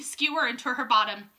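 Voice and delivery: plain reading voice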